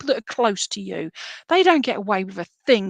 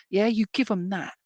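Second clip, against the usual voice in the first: ordinary talk, nothing marked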